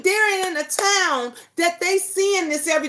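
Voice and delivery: normal talking voice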